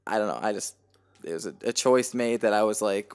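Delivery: normal talking voice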